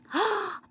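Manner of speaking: sharp high gasp of an idea; gasp of discovery. A narrator's reading. Amelia Bedelia spotting the cookies, a sudden gasp.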